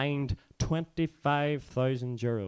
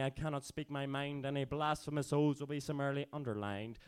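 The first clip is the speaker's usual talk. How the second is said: spoken-word performance; 4 semitones higher, 3 dB quieter than usual. Acted. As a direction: drop softer and let the anger simmer. Quieter verse, slowly sinking.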